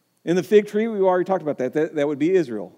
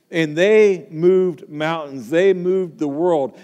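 slow, louder, each word weighed; slow emphatic proclamation; 4 dB louder, slower than usual. Acted. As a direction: preach it word by word, building conviction. Preacher proclaiming the early church's power.

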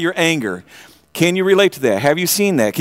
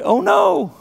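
frightened servant; 12 semitones higher than usual. Shown as a demonstration. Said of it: "You got that up there, right?".

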